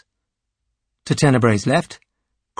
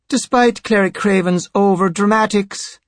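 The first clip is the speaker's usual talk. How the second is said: high-pitched priest, in command; imperious high priest; 7 semitones higher than usual. A narrator's reading. High Priest ruling on the clerics' quarrel, imperious. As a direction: speak as the superior passing judgement.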